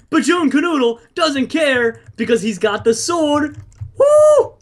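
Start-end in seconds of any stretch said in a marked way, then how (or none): 4.0-4.5 high whoop. Real, not acted. Celebrating in a fight.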